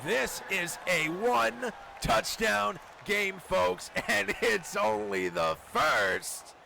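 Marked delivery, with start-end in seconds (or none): none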